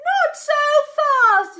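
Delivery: high, loud yell; shouting at the rival racing ahead. Acted. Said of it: "yelled Arthur".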